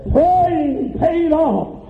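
high, slow, drawn-out preaching cry; fervent preaching. Real, not acted. Preacher testifying to church about a praying father, fervent preaching. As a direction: proclaim each phrase in rising testimony.

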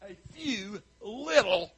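belittling. Acted. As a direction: stretch it out to make it sound pitifully small. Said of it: "didn't have to say it like that".

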